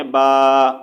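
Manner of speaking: با held with the mouth fully open; open long baa. Shown as a demonstration. Teacher models the madd alif, long open با. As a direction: sound با straight forward, mouth wide. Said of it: "سامنے کی طرف ہم نے اس کی آواز نکالنی ہے".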